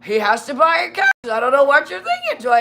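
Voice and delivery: high, loud scolding captain; mock reprimand. Captain reprimanding Joy over the kegs, hammed-up scolding. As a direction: scold her like an outraged captain. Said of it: "he literally reprimanded me".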